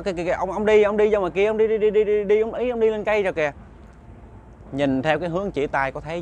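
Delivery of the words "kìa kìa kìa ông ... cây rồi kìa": put-on excited child pointing. Child pointing at unseen ghost.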